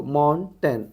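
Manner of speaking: Mountain pulled apart into two syllables; broken into syllables. Shown as a demonstration. Teacher decodes a transcription, mountain syllable by syllable. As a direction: sound out mountain part by part.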